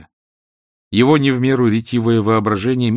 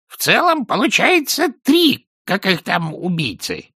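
slow, high-pitched talking bird; parrot voice; 12 semitones higher, 4 dB louder than usual. A narrator's reading. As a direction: sum it up slowly as a parrot. Parrot character sums up.

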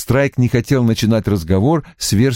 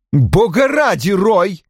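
higher-pitched exasperated exclamation; loud protest; 10 semitones higher, slower than usual. A narrator's reading. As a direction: cut in to stop the rant. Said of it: "громко сказала Ким". (громко, с возмущением)

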